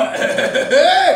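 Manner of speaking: acted burst of stuttering laughter; astonished chuckle. Jesus reacting to the woman's answer, astonished laugh. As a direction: laugh out in amazed disbelief.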